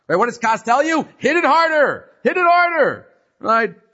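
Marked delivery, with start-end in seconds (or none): 1.2-2.1 high pushed command, mock frustration; angry urge. 2.2-3.1 higher, louder mock-angry command; angrier urge. Repeated angrier.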